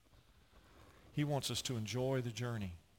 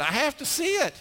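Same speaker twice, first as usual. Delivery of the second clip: much higher, louder whiny plea; pleading son; 9 dB louder than usual. Retold or imitated. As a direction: plead like a frustrated kid.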